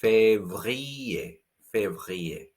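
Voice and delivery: février with a throaty French R; février, R from the throat, clear fay-vree-ay; février, uvular R. Shown as a demonstration. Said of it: "It's very much a French R".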